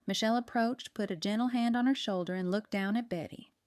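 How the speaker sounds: usual speaking voice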